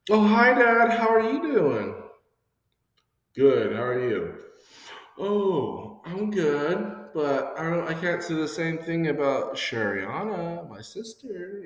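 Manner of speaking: high, sugary put-on innocent voice; butter dad up in a sweet high voice; fake sweet innocence. Child fakes innocence to dad before tattling, high sugary voice.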